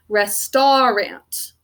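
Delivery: slow "restaurant" as res-tar-ant; drawn-out "restaurant" with ah, then short a; spelling pronunciation. Shown as a demonstration. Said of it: "The way we think to spell this word".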